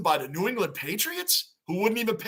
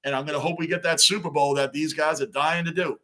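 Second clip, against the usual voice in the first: ordinary delivery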